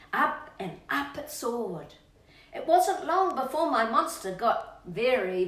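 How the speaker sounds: put-on reading to children. Reading the birthday verse to Rocky, bouncy and relishing. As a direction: savour every disgusting detail for the child. Reading rhyming picture book aloud.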